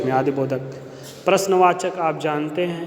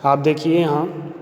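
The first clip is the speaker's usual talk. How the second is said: ordinary delivery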